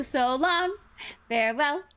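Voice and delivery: hammed farewell. Repeated louder farewell.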